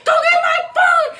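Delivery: high, loud panicked shouting; yelling at friend to fetch the phone; retold frantic yell. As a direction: scream the order at your friend. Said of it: "So I'm telling Letha".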